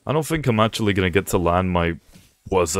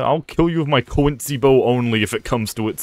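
ordinary talk, nothing marked

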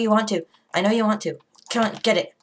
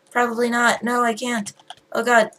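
normal talking voice

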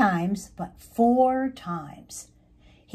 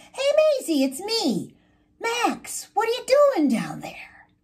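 high-pitched call to a friend below; storybook caterpillar calling down, high and eager; excited hailing; 11 semitones higher than usual. A narrator's reading. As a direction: hail your friend in a high voice. Max hails Maisie from afar.

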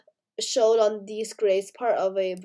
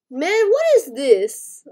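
surprised; 6 semitones higher, 8 dB louder, slower than usual. Real, not acted. Shocked at the game items.

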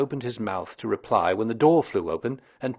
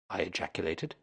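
plain reading voice

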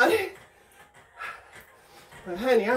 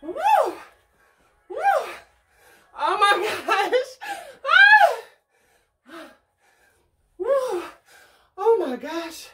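excited laughing shout; 8 semitones higher, 4 dB louder, slower than usual. Real, not acted. Real reaction during workout game.

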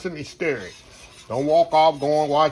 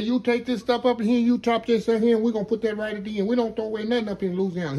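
put-on higher old Cajun cook, rattling it off fast; lightly voiced Cajun chef, fast and higher; imitating the old chef; 6 semitones higher than usual. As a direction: quote the old chef quickly, like a lesson. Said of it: "He said".

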